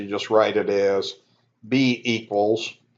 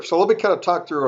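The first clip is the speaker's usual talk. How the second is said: talking through a yawn; words stretched by a yawn; 7 semitones higher, faster than usual. Real, not acted. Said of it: "Sorry, I'm yawning".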